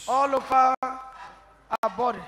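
loud, slow prayer call; hollering revival preacher. Acted. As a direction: proclaim fire, drawn out and strong. Prayer proclamation.

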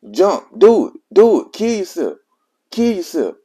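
high-pitched, pained mock cry; retold pained urging. Friend urging a suicidal caller, retold in a high pained voice. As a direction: imitate a distressed friend egging you on. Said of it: "he was in pain hearing me".

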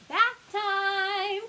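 high, loud pirate mommy call. A narrator's reading. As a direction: sing it out to summon the kids. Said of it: "calls out the pirate mommy".

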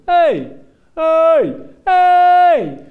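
loud high shouts of hey; stranger shouting. Retold or imitated. Stranger yelling after a cyclist, loud rising shouts. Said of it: "die begint te roepen".

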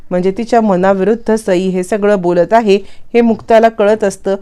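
plain reading voice

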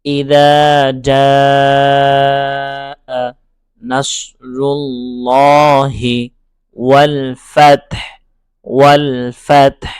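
each word chanted slowly and separately; slow, broken-up recitation, one word at a time. Shown as a demonstration. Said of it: "লফজান লফজান পড়ব, ভেঙে ভেঙে পড়ব".

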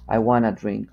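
"want to" reduced to "wanna"; American contraction. Shown as a demonstration. Teacher demonstrating everyday American speech, contracted "wanna". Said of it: "el americano dice".

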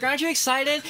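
high-pitched mock-eager question; gushing excitement. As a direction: play up the excitement. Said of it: "You're like".

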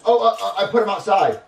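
high, loud, flustered stammer; stall, then blurt the excuse; stammering kid character. Acted. Junior covers for his rat to the chef, flustered.

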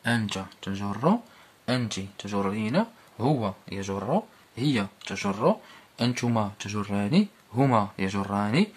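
ordinary delivery

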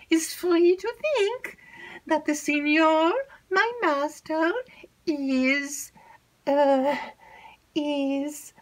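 high, halting stammer; nervous maid stammering out the news; hesitant maid. Acted. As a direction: stumble and stall before the secret. Annette stammers the secret.

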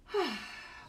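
long relaxed sigh; audible sigh. Acted. Showing the peaceful sigh to children, sighing. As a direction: let a slow breath out.

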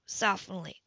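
ordinary delivery

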